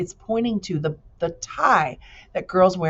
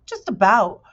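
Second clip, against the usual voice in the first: proud; 5 semitones higher, 6 dB louder than usual. A narrator's reading. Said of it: "with a hint of pride".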